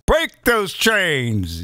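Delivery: slow, drawn-out mock Biden voice; Joe Biden impression. Mocking the Democrats as Joe Biden, slow and drawn. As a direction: drag it out like an old politician. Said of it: "as Joe Biden would say".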